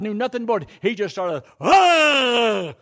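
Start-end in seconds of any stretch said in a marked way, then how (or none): 1.6-2.7 high, loud cry of a cornered bear cub; imitated cub holler. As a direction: holler at the lion as a last defense. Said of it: "just hollering at this lion".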